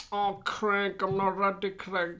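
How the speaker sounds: high whiny mock voice; mocking girlfriend voice. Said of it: "She's like".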